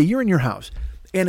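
usual speaking voice

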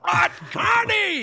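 high, slow showman bellow of a name; hammed-up curtain call introduction; mock announcer call. Announcer calling out the name at the curtain call, high and slow.

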